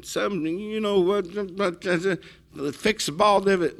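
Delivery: high-pitched mock rant, garbled and spluttering; imitated ranting. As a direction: act out an irate golfer raving. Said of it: "starts ranting and raving".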